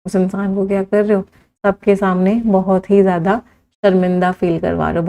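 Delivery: normal talking voice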